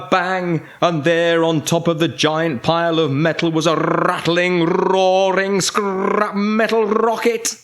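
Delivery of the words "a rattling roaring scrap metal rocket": rising, rolled-r build-up; dramatic reveal. A narrator's reading. Magic makes a new rocket, excited rising build-up. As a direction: build higher, rolling the r's with gusto.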